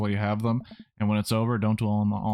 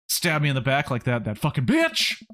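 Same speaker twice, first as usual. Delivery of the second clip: high outburst of betrayed anger; hurt anger; 4 semitones higher than usual. Acted. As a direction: build from hurt to an angry, high-pitched burst. Acted teen rages at friend's betrayal.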